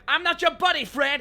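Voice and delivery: high, loud indignant cartoon Canadian; quoted Canadian squabble. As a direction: snap back loudly in a mock quarrel. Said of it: "They'd be like".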